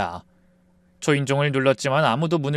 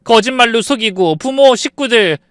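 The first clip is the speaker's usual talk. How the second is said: angry retort; 10 semitones higher, 9 dB louder than usual. Acted. Wife answers sister-in-law angrily.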